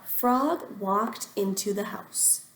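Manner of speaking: usual speaking voice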